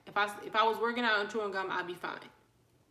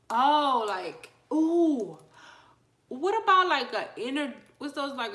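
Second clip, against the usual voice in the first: excited; 6 semitones higher, 10 dB louder, slower than usual. Real, not acted. Sudden idea about pre-workout gum.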